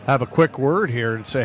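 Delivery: normal talking voice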